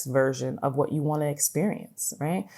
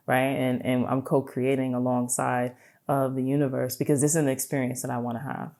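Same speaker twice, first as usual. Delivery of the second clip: ordinary talk, nothing marked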